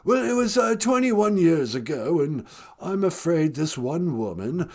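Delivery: a hint of a glum English prince; Prince Harry impression. Harry calling the podcast, mock British lament. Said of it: "Sweetie, it's Prince Harry".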